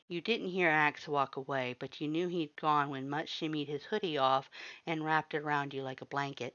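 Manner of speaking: ordinary delivery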